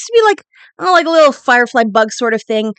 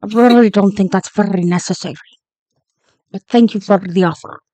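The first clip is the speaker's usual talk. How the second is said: lightly voiced captain, lower; captain turning down a job offer, a bit lower; hard captain voice; 6 semitones lower than usual. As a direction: politely decline in the captain's voice. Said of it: "this voice is hard".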